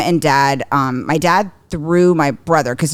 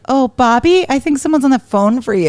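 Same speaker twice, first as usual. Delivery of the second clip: high, mock-sweet call; teasing; 7 semitones higher than usual. Sister baiting her brother with his nickname, teasing. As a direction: bait him with fake innocence.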